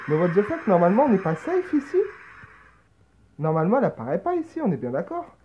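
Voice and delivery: panicked, high and loud; panicking gamer. Real, not acted. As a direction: freak out at the jump scare. Monster appears in safe spot.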